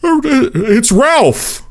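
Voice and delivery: high, eerie stalker voice; Ralph. Retold or imitated. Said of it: "sounded exactly like Gary Oldman's character".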